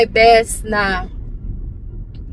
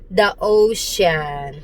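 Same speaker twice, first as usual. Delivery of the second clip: very slow, stretched "ocean"; long, deliberate "ocean"; careful correction; 3 semitones lower, much slower than usual. Retold or imitated. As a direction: spell it out by drawing out "ocean". Acted careful correction of misheard word.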